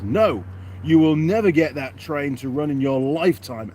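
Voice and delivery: loud, emphatic shouted read-out; shouty. Acted. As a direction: read it out like all-caps shouting. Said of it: "in shouty letters".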